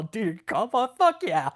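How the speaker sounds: lightly put-on pumped-up voice; imitating friend. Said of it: "He's all".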